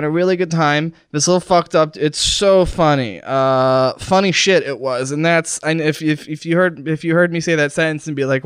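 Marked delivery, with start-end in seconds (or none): none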